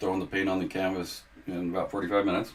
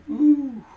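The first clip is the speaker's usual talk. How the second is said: high delighted ooh; 10 dB louder than usual. Real, not acted. Delighted at the painting.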